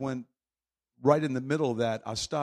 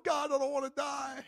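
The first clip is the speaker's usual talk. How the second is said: much higher, comic frightened plea; mock panic; 11 semitones higher, 5 dB quieter than usual. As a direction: squeak out a scared prayer. Retold panic facing a bull.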